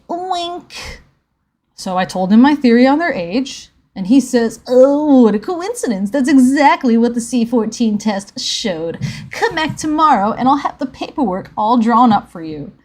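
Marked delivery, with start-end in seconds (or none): 0.1-1.0 high-pitched little "wink"; cartoon sound-effect wink, pitched way up; spoken wink. Voicing scientist's wink aloud. 4.7-12.7 loud hammed-up amazement; put-on astonishment at a convenient coincidence; loud, knowingly fake surprise; mock surprise. Acting the scientist feigning surprise.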